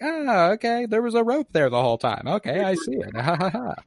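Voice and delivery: high, loud fake-relieved crowd reaction; mock realization. Audience buying the rope trick, mock realization. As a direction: play an audience suddenly convinced, ending in fake laughter.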